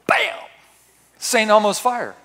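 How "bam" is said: very high, sharp burst; sudden exclamation. Acted. Preacher marking the moment power strikes, a sharp high burst. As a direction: snap it out like a thunderclap.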